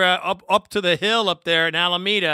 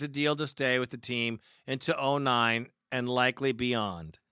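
news article read; 2 semitones lower, 4 dB quieter than usual. Acted. Reads news article aloud.

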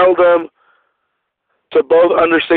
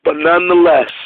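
ordinary talk

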